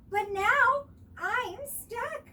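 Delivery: high, slow, loud worried fawn; stuck fawn. A narrator's reading. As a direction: let the worry slow it down. Baby Deer stuck.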